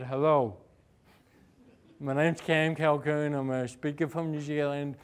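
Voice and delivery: slowed down. Retold or imitated. Caller redoing his intro after being told to slow down, slow and low. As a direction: deliberately drag out every word. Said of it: "Slow down".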